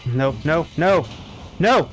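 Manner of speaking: rising into a loud high scream; panicked no. Real, not acted. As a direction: refuse in panic as it attacks. Another enemy appears.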